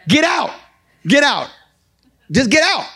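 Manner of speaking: high, sharp command to leave; commanding shout. Acted. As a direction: bark it like an order. Preacher orders out of bad relationship.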